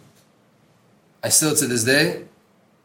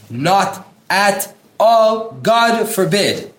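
"not at all" and "God" drawn out slow, much higher and louder; bold emphasis; 7 semitones higher, 5 dB louder, much slower than usual. Shown as a demonstration. Teacher stressing the bold words of the book, slow and loud. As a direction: stretch each word out, as if printed in bold. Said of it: "Everything I'm emphasizing, it's 'cause it's bold".

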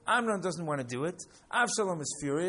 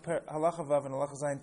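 normal talking voice

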